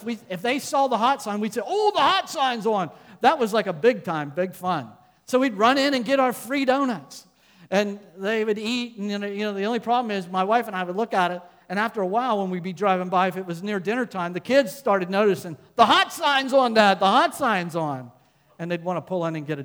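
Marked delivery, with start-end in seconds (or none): 1.6-2.9 higher, excited exclamation, retold; mock thrill at spotting a treat; excited family exclamation. Parents to their girls on seeing the hot sign, excited. 15.8-18.1 put-on louder, higher kid-like calling out; imitating excited kids. Kids to dad, driving by the donut shop, excited.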